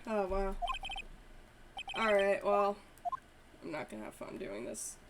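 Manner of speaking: ordinary talk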